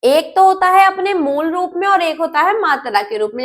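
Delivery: ordinary talk, nothing marked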